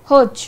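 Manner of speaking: হজ cut off sharply at the জ; abrupt closed হজ; clipped hoj. Shown as a demonstration. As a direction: stop dead on the last sound of হজ. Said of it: "হজ বলে কিন্তু আমি থেমে যাচ্ছি".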